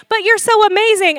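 high, mock-encouraging protest; put-on doting parent voice; gushing parent. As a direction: overdo reassuring a teenager. Acting a reassuring parent to teenager.

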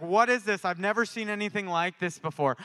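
loud, high astonished outcry; retold amazement. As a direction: imitate an overwhelmed bystander. Retold cashier shaking in amazement.

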